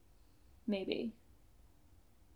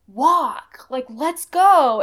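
loud, slow retold yell at a dog; 4 semitones higher, 11 dB louder, much slower than usual. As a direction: bark the order out loud and slow. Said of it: "yelling at the dog".

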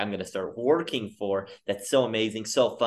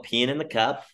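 normal talking voice

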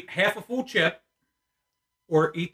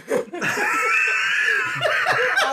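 loud high shrieking laugh; 3 dB louder than usual. Real, not acted. Burning from pepper, laughing.

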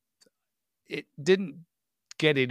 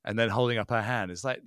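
ordinary talk

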